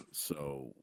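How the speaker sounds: ordinary talk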